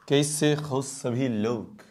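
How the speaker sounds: usual speaking voice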